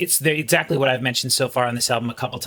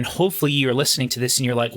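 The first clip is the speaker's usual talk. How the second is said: ordinary talk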